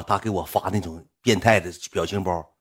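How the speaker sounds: ordinary delivery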